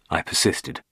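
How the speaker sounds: usual speaking voice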